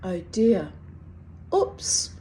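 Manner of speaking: normal talking voice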